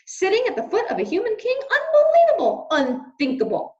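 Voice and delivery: high, loud, fast outrage; shrieking dismay, fast; outraged shout. Acted. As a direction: rant at the scandal. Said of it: "he shouted".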